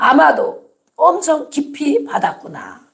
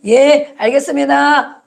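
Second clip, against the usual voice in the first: slow, higher-pitched obedient answer; submissive yes; 4 semitones higher, 4 dB louder, slower than usual. Acted. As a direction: draw out a dutiful yes to a master. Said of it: "절하는 사람이 되어지고". (길게 늘여 공손히 순종하는 대답)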